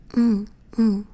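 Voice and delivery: Mm, mm, the isolated NG sound; NG sound demo. Shown as a demonstration. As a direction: hum the NG consonant twice, firmly. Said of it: "That's the sound it makes".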